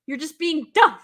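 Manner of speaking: loud, high scolding of a dumb character; No! as a loud outburst, then scold; mock yelling at the screen. Viewer shouting at a horror character, loud scolding.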